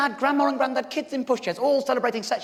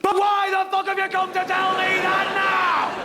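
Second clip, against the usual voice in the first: angry outburst; 7 semitones higher than usual. Acted. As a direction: turn on them in anger. Angry snap after apology.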